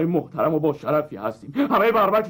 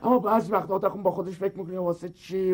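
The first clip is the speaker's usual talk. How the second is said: ordinary talk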